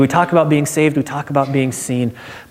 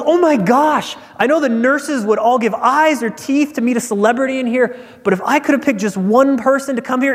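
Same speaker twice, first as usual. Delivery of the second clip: much higher, giddy starstruck doctor; gushing fangirl; 8 semitones higher than usual. Retold or imitated. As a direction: gush like a teenage fan meeting her idol. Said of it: "starts acting like a thirteen-year-old girl at a boy band concert".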